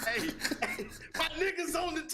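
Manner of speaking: high, laughing call to the TV; a hint of a rowdy inmate shouting; mock excited inmate. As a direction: hype the cellmates up, half laughing. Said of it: "I'd have had all the inmates".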